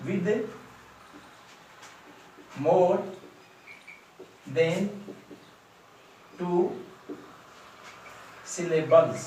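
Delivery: each word set apart by long pauses; spaced-out teaching read; word-by-word dictation. Shown as a demonstration. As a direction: dictate it slowly, one word at a time. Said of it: "इसको अपन बोलेंगे".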